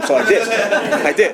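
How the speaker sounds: chuckling through it; laughing. Real, not acted. Laughing while owning up to the crowd. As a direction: laugh it off as you admit it. Retelling staying away, laughing.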